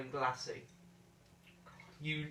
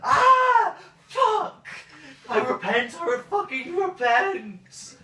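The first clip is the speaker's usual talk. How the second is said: shrieking high with cracking voice; pained screaming, voice breaking; 15 dB louder, slower than usual. Acted. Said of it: "With the voice breaks".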